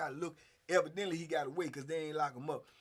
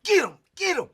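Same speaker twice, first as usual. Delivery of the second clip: high, loud shouted urging; egging on; 11 semitones higher, 15 dB louder than usual. Retold or imitated. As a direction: shout him on from the side. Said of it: "And, and Spoon like".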